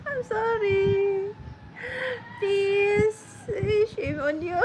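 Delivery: high, drawn-out sorry through laughter; laughing apology. Real, not acted. Apologising to Cassie for forgetting, laughing and high-pitched.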